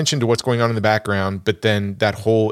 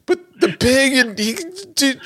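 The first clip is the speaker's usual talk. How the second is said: high sobbing whine. Retold or imitated. Husband wailing to his wife in the theater, mock sobbing squeak. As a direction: pitch jumps way up in a mock blubbering wail. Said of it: "And I'm like".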